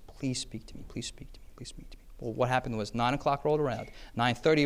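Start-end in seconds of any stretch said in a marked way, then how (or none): 0.1-0.8 soft pleading. Retold or imitated. Pleading prayer fading. 0.9-2.0 fading to a whispered prayer; hushed pleading prayer; whispered plea. Retold or imitated.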